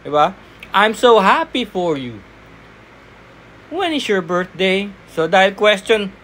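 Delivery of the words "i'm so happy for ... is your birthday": cheerful sample line. Shown as a demonstration. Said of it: "Hindi naman pwedeng ganun".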